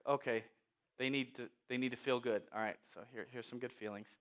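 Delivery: low, soft, halting concession; resigned indulgence. Acted. God giving in to children who just want good feelings, quietly resigned.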